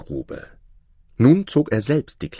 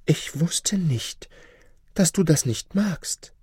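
much higher woman's voice; meek sorceress; 9 semitones higher, slower than usual. Acted. Sorceress excusing herself to her lover, high meek voice. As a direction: plead innocence in a high, drawn-out voice.

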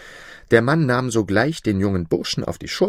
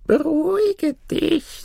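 very high, slow crone voice; calm the beast in a tiny, drawn-out old voice; high little old woman, slowly placating; soothing old granny; slower than usual. Acted. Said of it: "sagte das Mütterchen".

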